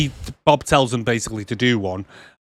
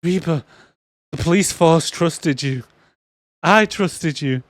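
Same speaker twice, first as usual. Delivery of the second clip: slow, higher, hammed-up betrayal speech; mock reproach; 6 semitones higher, slower than usual. Bob scolding his bent partner Reba, slow mock reproach. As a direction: lay on the hurt, word by word. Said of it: "Bob goes".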